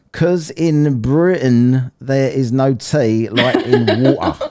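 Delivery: WATER said slowly with its T dropped, laughing; pointed British diction. Shown as a demonstration. Host mocks a viewer's comment about British Ts, exaggerated dropped T. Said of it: "I'm using it as an example".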